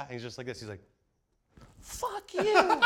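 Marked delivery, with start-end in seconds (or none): 1.8-2.8 put-on very high, loud, laughing child's jab; high-pitched laughing mock kid; kid's insult. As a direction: squeak it out like a cheeky eight-year-old.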